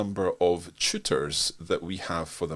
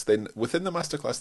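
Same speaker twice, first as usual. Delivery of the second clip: ordinary talk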